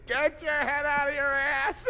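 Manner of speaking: loud, high jeering holler; loud mocking yell across the garage; teasing yell. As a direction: shout it to needle him.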